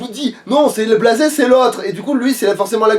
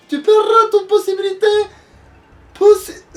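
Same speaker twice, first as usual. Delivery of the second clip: a much higher sorceress casting a curse; witch voice; 9 semitones higher than usual. Acted. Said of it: "je vais faire cette voix en fait".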